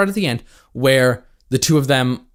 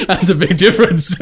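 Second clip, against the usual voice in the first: teasing through laughter; laughing; 13 semitones higher, 5 dB louder than usual. Real, not acted. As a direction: barely get it out for laughing. Cracking up at the admission.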